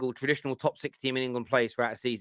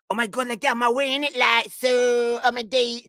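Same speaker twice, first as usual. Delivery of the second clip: fake street accent; 8 semitones higher, 3 dB louder than usual. Host mimicking a girl on the train, fake street accent. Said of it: "did you see that fake accent that girl had on the train?".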